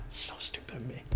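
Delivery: barely audible, whispered aside to self; hushed admission of foolishness; whispered self-reproach. Retold or imitated. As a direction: drop to a whisper, chiding yourself. Muttered self-reproach after the rant.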